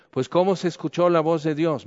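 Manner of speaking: ordinary talk, nothing marked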